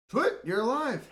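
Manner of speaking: loud, high mock surprise. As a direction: greet him as if amazed. Lieutenant greets Twit.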